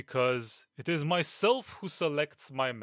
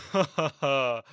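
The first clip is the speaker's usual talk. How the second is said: scornful laugh; 3 semitones lower than usual. Acted. Captain scoffs.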